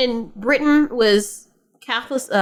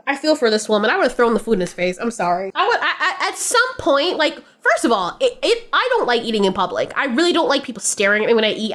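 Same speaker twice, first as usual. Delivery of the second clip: normal talking voice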